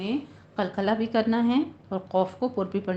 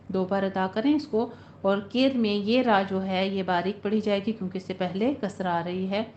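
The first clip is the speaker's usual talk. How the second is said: ordinary talk, nothing marked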